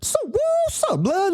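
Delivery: high mock gangster greeting; exaggerated street greeting. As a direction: stretch it out high like a cartoonish gangster. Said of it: "Or like".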